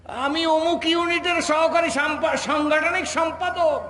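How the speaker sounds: high, slow, self-important announcement; puffed-up official. Acted. Preacher mocking a minor party official's title, puffed-up voice. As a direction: imitate an officer reciting his title, chest out. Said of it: "বুক এদিকে বাঁকা হয়ে গেছে".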